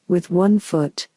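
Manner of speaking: usual speaking voice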